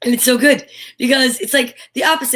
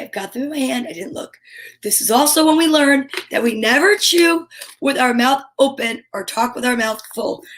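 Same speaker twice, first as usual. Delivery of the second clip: ordinary delivery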